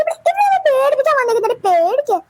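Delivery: ordinary talk, nothing marked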